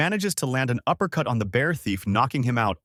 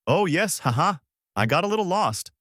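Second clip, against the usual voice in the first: lightly voiced turtle, higher, chuckling; turtle's cheery reply; 8 semitones higher than usual. A narrator's reading. Turtle explaining itself to strangers, lightly chuckling. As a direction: admit it with a small, high ha-ha.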